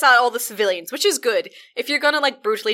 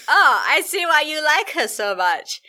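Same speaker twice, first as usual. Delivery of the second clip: slow and knowing, a bit higher; a hint of a smug crime boss; sly gang boss; 6 semitones higher, 3 dB louder than usual. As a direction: purr it with smug approval. Boss voiced slyly.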